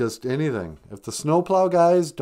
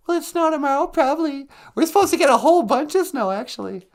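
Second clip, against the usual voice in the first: very high, fast squeaky character voice; 14 semitones higher, 3 dB louder, faster than usual. Acted. The sidekick chirps a weather question at the host in a squeaky voice. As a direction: chirp it out like a tiny puppet.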